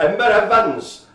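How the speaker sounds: put-on singsong Welsh accent. Radio host naming a client to co-host, mock Welsh accent. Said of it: "Have to do the Welsh accent".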